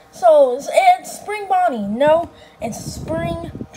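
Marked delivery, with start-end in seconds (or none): none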